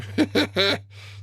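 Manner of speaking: éclat de rire plus aigu, qui retombe. Real, not acted. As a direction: laisse échapper un rire amusé. Rit de l'Aiden. (petit rire amusé)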